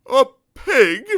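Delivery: high, loud elephant trumpet. Acted. Elephant mocking Mister Dizzy's answer, trumpeting. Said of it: "he trumpeted".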